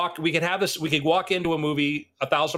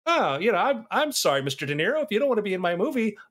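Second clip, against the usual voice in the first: lightly voiced director, higher-pitched mock apology; a hint of a dismissive director, pitched up; Tarantino; 7 semitones higher than usual. Director turning away a star actor, lightly acted mock apology. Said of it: "a guy like Tarantino is like".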